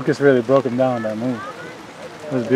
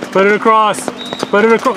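loud, high sideline call; yelling from the sidelines; 7 semitones higher, 4 dB louder than usual. Real, not acted. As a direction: urge the pass across the field. Parent urging pass from sideline.

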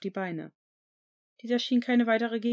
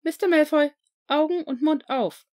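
higher, louder stern command; stern nurse; 8 semitones higher, 10 dB louder than usual. A narrator's reading. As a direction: order the patient like a strict matron. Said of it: "sagte sie streng". (strenge Krankenschwester befiehlt dem Patienten, höher und lauter)

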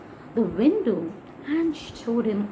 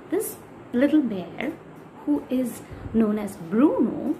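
usual speaking voice

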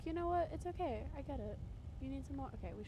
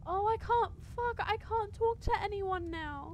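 1 loud frustration; 9 semitones higher, 9 dB louder, slower than usual. Real, not acted. Frustration at game limit.

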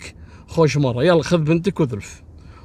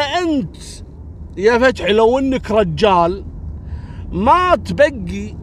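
angry taunt; 7 semitones higher than usual. Retold or imitated. Neighbour shaming Fathi before people, angry raised taunt. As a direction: hurl the insult in public. Badr insults Fathi in quarrel.

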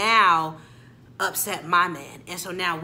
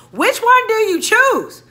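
exasperated outburst; 13 semitones higher, 11 dB louder, slower than usual. Real, not acted.

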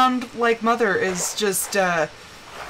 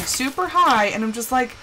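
ordinary talk, nothing marked

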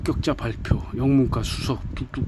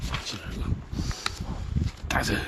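ordinary delivery

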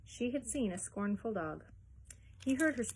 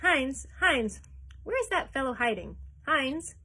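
loud, impatient shouting for the servant; gruff duke calling out loudly; bellowing; 5 semitones higher, 7 dB louder than usual. A narrator's reading. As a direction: yell across the yard for him.

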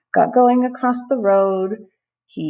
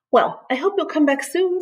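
lightly voiced snail; 10 semitones higher than usual. Snail bids farewell.

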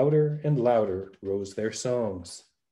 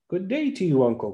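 a lightly voiced simple lad calling down, a little higher; the fool calling out; 6 semitones higher than usual. A narrator's reading. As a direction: call down cheerfully from the sky ship. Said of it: "cried the fool".

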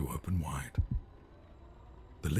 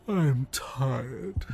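put-on high, loud childlike whine; a child whining at bedtime; whiny little prince; 9 semitones higher, 6 dB louder than usual. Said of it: "said the prince".

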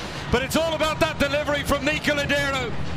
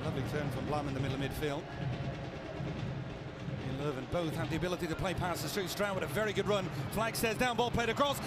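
usual speaking voice

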